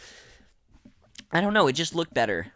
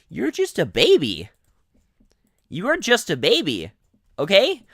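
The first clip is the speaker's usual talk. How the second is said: mocking; 7 semitones higher, 8 dB louder than usual. Real, not acted. Mocking complainers.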